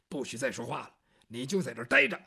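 growled low, menacing order; low stern threat. Acted. Hero warning the gagged captive, low and stern. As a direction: lean in and threaten him low.

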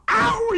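high, drawn-out "ao" cry; imitated startled yelp. A narrator's reading. Narrator imitating the bride's shock at her groom, high startled yelp. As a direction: let out a long, high mock yelp. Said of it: "嗷一声".

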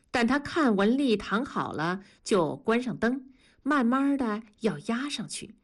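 ordinary delivery, nothing marked

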